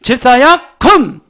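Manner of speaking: loud high call to raise; loud command. Shown as a demonstration. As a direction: command him to rise. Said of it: "한번 해보겠어요".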